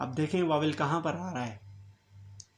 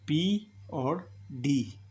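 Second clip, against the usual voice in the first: पी और डी drawn out very slowly, letter by letter; letters spelled slowly; much slower than usual. Shown as a demonstration. Teacher slowly spelling consonants for students. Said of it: "कौन कौन से consonant use हुए हैं".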